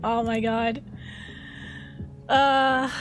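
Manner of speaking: ordinary talk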